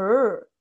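loud, held untrilled "rrr"; English R sound. Shown as a demonstration. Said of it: "La R no es así tan marcada".

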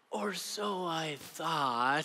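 slow hushed whisper; ominous whisper. Acted. Storyteller hinting at the prank, slow whisper. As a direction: whisper the twist slowly.

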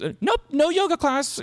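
high-pitched caricature of a dismissive therapist; mock chirpy therapist. Therapist reporting back on the class next door, mock high voice. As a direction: sing out a squeaky mock reassurance.

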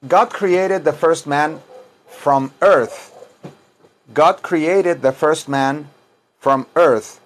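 slow English model sentence; deliberate, phrase-by-phrase reading; slow teaching diction. Shown as a demonstration. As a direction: say it slowly for learners to repeat.

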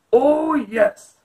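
loud, booming dragon boast; proud monster bellowing yes; growling dragon. Acted.

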